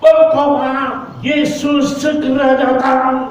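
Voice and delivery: high, slow, drawn-out call to repent; old man urging passengers to repent, high slow proclaiming call. Retold or imitated. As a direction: call out to the whole train carriage, slow and high. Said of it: "dia katakan".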